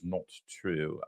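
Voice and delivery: imitated British accent. Host imitates Oprah denying payment, mock British accent. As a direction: mimic a rounded British "not true". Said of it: "she has a British accent".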